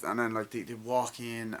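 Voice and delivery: ordinary talk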